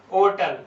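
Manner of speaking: "होटल" with the h dropped, like otal; silent H. Shown as a demonstration. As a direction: start होटल straight on the vowel. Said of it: "ज्यादा जोर ना दिया जाए". (होटल को ओटल जैसा, बिना ह के बोलें)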